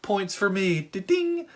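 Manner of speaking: high, singsong little victory cheer; playful cheer. Retold or imitated. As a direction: celebrate a tiny win like a game show. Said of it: "I was like".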